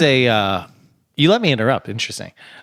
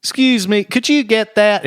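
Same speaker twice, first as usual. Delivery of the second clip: cartoon character voice; 7 semitones higher than usual. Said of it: "but he's like".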